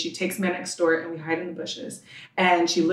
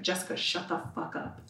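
hushed retold dismissal; 12 dB quieter than usual. Father dismissing his screaming child, retold in a hushed voice. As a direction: drop to a near-whisper for the quoted put-down.

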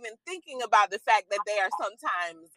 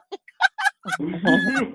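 high burst of laughter; 4 dB louder than usual. Real, not acted. Laughing at being scolded.

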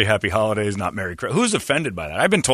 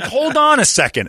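high, loud startled protest; retelling his shocked reaction at the golf course; 6 dB louder than usual. Retold or imitated. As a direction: stop him, shocked. Reacting to insult.

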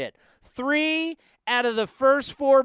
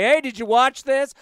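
raised, slightly louder retold challenge; challenging quote; 5 semitones higher, 4 dB louder than usual. Host retelling how he confronts a colleague, challenging. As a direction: needle them with a mock question.

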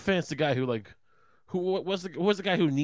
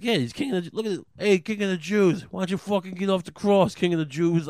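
loud, raised-pitch heckling; mocking jeer; 7 semitones higher, 5 dB louder than usual. Crowd mocking the man on the cross, jeering. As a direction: taunt him with his own title. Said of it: "They were mocking the guy".

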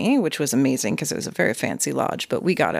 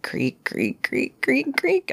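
imitated creaking; 10 semitones higher, much slower than usual. Climbing the attic stairs to the bedroom, creaking. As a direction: sound out each step on old stairs.

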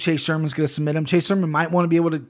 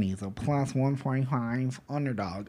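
much quieter, slow, low put-on voice; a sudden dark, drawn-out announcer read; odd low voice; 3 semitones lower, 9 dB quieter, slower than usual. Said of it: "I don't know why I did that with my voice".

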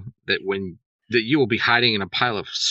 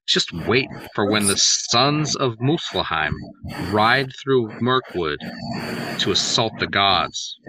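slow, ominous prophecy; slow menacing threat; slower than usual. Pretend. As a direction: draw out each threat slowly.

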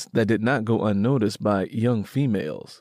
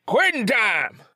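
a high, hollered call across the work gang; 12 semitones higher than usual. Acted. Boss calling the gang to quit work, high holler. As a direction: holler it to end the shift. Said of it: "Strong Boss called out".

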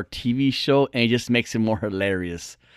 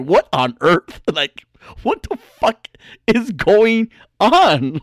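disbelief; 6 semitones higher, 4 dB louder, slower than usual. Retold or imitated. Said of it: "I'm like".

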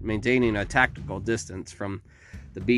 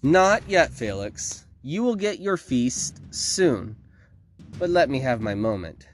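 higher villainess, slowing to coax; commanding villainess; 3 semitones higher than usual. A narrator's reading. Victoria makes her beast wait, commanding. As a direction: hold the beast back imperiously.

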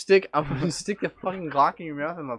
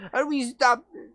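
mock desperate plea; 13 semitones higher, 4 dB louder than usual.

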